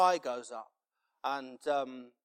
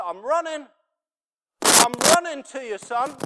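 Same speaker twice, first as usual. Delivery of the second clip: high, very loud impassioned shout; father's shouted answer; 12 semitones higher, 16 dB louder than usual. Acted. Father answering his son's cry for help, full-voiced shout. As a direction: shout it out to a child far off.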